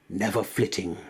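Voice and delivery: ordinary delivery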